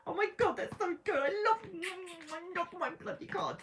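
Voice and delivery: high squealing delight; overjoyed. Real, not acted. Tarot reader gushing to viewers over her cards, high delight. Loving the card spread.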